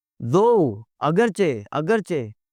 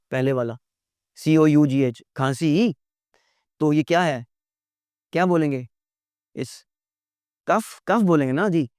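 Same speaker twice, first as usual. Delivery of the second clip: usual speaking voice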